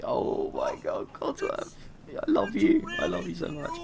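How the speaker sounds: breathless squeaky gush of love, very high on Codsworth; gushing squeal. Pretend. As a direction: swoon over him in a high, airy squeal. Gushing over robot butler.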